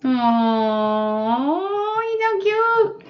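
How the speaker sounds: slow coo climbing very high; adoring coo. Real, not acted. Streamer gushing over a cute little one, adoring coo. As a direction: swoop up into a high, drawn-out coo.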